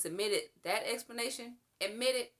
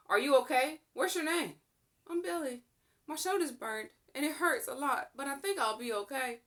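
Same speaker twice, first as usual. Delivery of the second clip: put-on lightly raised young boy voice; a hurt boy answering, a touch higher; child voice; 8 semitones higher, 3 dB louder than usual. To the rescuer, a hurt boy answering in a lightly higher voice.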